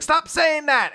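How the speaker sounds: high, loud mock protest; hammed-up fed-up daughter; exasperated outburst. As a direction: shout it in high-pitched exasperation. Retold daughter protests at dad.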